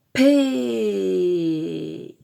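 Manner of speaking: loud start on "P", stretched and falling; drawn-out syllable. Shown as a demonstration. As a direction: hold "P" as one long vowel, gliding down. Teacher blends letters into syllable.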